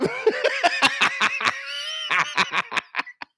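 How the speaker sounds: shrill, breathless burst of laughter; high cackling laugh. Real, not acted. Streamer cracking up at his own impression, high laugh.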